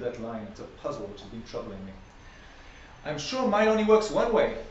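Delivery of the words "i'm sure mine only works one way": Alice. A narrator's reading. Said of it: "Alice remarked".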